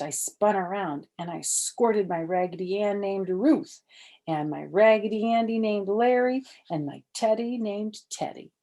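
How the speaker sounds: ordinary delivery, nothing marked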